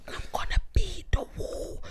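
hushed secret plan; whisper. Retold or imitated. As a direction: whisper so mum behind the door can't hear. Dad whispers so mum won't hear.

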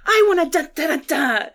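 loud, high excited cheer; thrilled shout with a laugh. Retold or imitated. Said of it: "I'm like".

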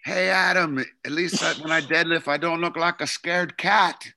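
put-on a Scottish lifter teasing in a broad Scottish accent. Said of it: "he's got the thick Scottish accent".

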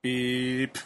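ordinary delivery

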